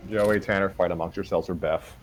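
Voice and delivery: plain reading voice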